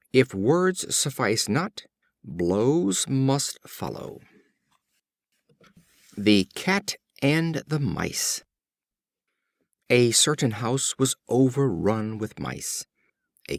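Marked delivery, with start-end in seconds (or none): none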